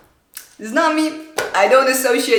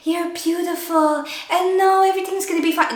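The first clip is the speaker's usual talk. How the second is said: sing-song mock positivity; 8 semitones higher than usual. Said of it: "thoughts like".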